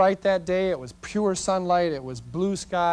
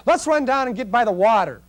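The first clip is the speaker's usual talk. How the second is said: higher, eager imitation of an excited client; 5 semitones higher, 4 dB louder than usual. Client urging everyone down to the water, excited. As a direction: pipe up with keen enthusiasm. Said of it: "she's all excited".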